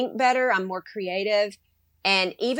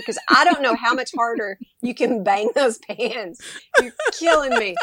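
laughing through a mock complaint, higher at the end; 4 semitones higher, 4 dB louder than usual. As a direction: tease the husband while laughing.

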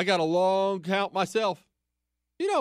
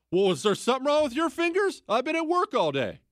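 raised, high-pitched indignant comeback; higher, louder, acting out a husband's testy reply; defensive retort; 10 semitones higher, 4 dB louder than usual. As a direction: fire back loudly like an affronted husband. Defensive husband snaps back at wife.

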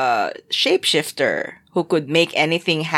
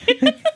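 high squealing laugh. Real, not acted. Laughs after name mixup.

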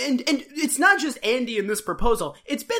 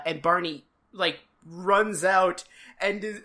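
ordinary talk, nothing marked

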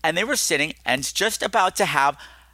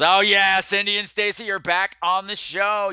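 hammed-up delight, pitched up; mock-excited welcome; 5 semitones higher than usual. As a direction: sing out the returning guests, stretching the end. Mock-excited announcement of returning guests.

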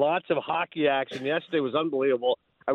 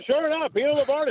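much higher, announcer-style projection; big projecting voice; 10 semitones higher than usual. Retold or imitated. Analyst on a friend of the show, big projected voice. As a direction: imitate a broadcaster's big voice coming at you. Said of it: "just big voice coming at you".

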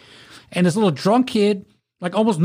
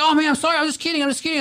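mock apology; 10 semitones higher, much faster than usual. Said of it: "he's like".

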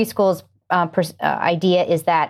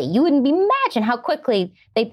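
usual speaking voice

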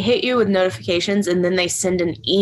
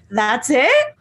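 imitated surprise, rising at the end; mock celebrity question; 2 semitones higher than usual. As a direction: ask it like an underwhelmed star. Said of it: "the interaction of the".

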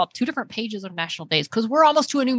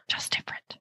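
whispered; 12 dB quieter than usual. Real, not acted. Echoing the phrase softly.

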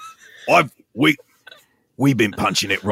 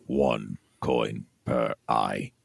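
ominous ferryman; 7 semitones lower, 6 dB quieter, much slower than usual. Acted.

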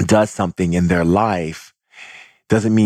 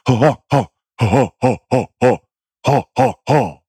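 rhythmic forceful "ho" bursts; short, raised, driving exhalations; energy-clearing huffs; 4 semitones higher, 3 dB louder than usual. Shown as a demonstration. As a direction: punch out each "ho" on the breath. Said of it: "clear energy and go".

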